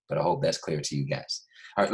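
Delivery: normal talking voice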